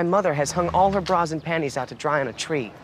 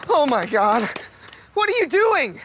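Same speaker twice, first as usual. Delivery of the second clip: shocked; 8 semitones higher than usual. Acted. Shocked at mother painting.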